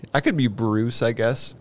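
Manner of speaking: ordinary talk, nothing marked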